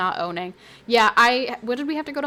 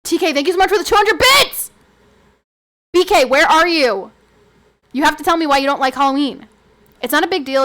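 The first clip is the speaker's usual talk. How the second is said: louder excited thanks to chat; loud call-out; 4 semitones higher, 10 dB louder than usual. Real, not acted. Streamer loudly thanking chat for bits, excited. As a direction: hype up the donor and call out.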